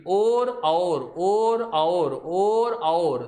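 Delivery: और stretched, switching between "oh" and "au" vowels; contrasting O and AU. Shown as a demonstration. Teacher shows the difference between two vowels, contrasting pronunciations. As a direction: alternate long pure "or" with gliding "a-ur" for और. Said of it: "ओ और औ में अंतर पता चल जाते हैं".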